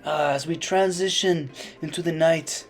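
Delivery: ordinary delivery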